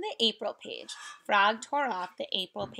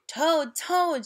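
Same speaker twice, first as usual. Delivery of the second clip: loud, higher call through a door; shouting; 6 semitones higher, 4 dB louder than usual. Acted. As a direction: call out to wake a friend. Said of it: "shouted Frog".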